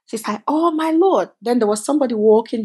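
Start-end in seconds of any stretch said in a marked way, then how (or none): 0.5-1.3 high, delighted exclaim; retold squeal of delight; excited cry. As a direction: cry out in amazement. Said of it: "she screamed".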